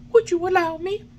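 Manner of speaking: put-on high wooden-boy voice, anxious; high puppet boy. A narrator's reading. Pinocchio answering the carabineers, anxious and high.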